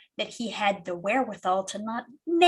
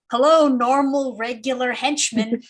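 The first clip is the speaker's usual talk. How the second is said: bright, louder put-on meek voice; mild-mannered voice; 4 semitones higher, 6 dB louder than usual. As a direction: play it innocently harmless on the phone. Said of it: "does the mild-mannered move work".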